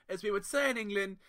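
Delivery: ordinary delivery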